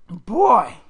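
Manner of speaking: put-on loud, clipped call of "Boy"; stern foster mother. A narrator's reading. Foster mother to the boy, loud sharp call. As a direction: summon him with disdain. Missus Amos addresses the boy.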